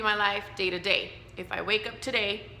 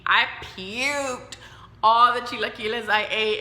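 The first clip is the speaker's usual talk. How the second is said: ordinary talk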